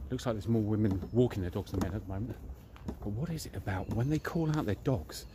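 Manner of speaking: ordinary talk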